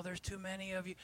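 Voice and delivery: ordinary talk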